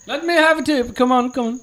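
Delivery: put-on high, pleading Moroccan accent. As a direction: beg for a tip in a Moroccan accent. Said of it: "dead on Moroccan accent".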